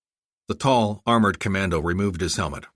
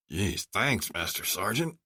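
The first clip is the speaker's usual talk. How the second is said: softer moaning complaint; 6 dB quieter, slower than usual. Acted. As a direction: groan it out, wounded. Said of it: "Boomer moaned".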